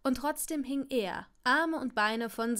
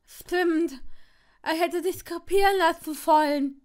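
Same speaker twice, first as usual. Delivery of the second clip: high, loud wailing retort; agitated wail; 6 semitones higher, 10 dB louder than usual. A narrator's reading. Longbottom snapping back at Draco in the web, wailing.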